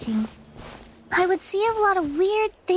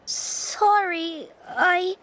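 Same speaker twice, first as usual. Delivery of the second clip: teary; 3 semitones higher, slower than usual. Acted. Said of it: "gets distracted easily by crying".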